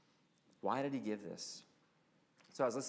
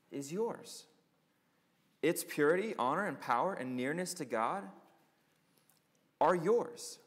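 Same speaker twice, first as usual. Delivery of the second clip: quoted preaching; 3 semitones higher than usual. Acted. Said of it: "Listen to what he says".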